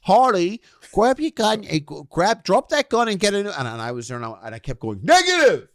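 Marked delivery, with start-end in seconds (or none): 0.0-3.5 put-on high, excited Australian kid voice calling orders; imitating an eager Australian kid; higher-pitched Aussie kid shouting instructions; mock Australian kid. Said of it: "I thought he was, uh, Australian". 5.0-5.7 high, loud barked "Negative!"; hammed-up soldier shout; mock military shout. Mock soldier reply in game.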